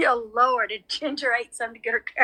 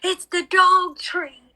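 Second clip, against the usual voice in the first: shocked realization; 7 semitones higher, 4 dB louder, much slower than usual. Retold or imitated. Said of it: "I was like".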